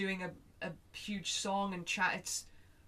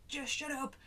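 higher-pitched, exasperated plea; acted frazzled snap without raising the volume; stressed outburst; 7 semitones higher than usual. Stressed owner to whining dog in the car, exasperated. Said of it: "I am vocalizing this way".

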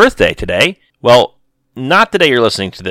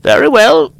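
high, louder, resigned; old holy woman; 8 dB louder than usual. Acted. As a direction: give in gravely. Old seer voiced in story.

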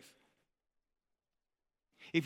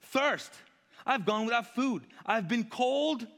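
high, louder list of miseries; Paul's rant; 8 semitones higher, 4 dB louder, slower than usual. Acted. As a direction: keep piling up the sufferings indignantly. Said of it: "Paul on a rant".

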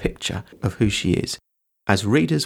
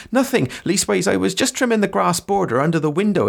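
much higher, deferential servant voice; high-pitched, humble gardener; flustered servant; 8 semitones higher than usual. Acted. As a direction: plead innocence in a high rustic voice. Reads Sam's dialogue aloud.

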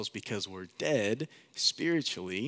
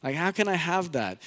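higher, casual asking voice; eager Nicodemus; 8 semitones higher, 4 dB louder than usual. Retold or imitated. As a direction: ask like a curious buddy. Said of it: "Nicodemus is like".

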